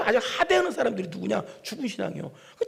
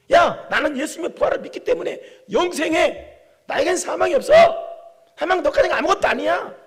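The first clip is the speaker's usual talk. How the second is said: higher, louder, sneering at death; mocking taunt; 9 semitones higher, 8 dB louder than usual. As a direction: taunt death like a schoolyard bully. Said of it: "그런 소리예요, 이게".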